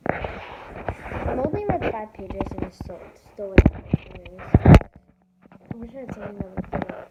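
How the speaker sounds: loud and fast, the voice pushing up hard; excited complaint. Real, not acted. Kid vlogger to viewers about the book, loud and rushed. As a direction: blurt it out to the viewers at full volume.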